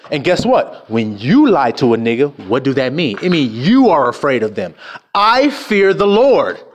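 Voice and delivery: usual speaking voice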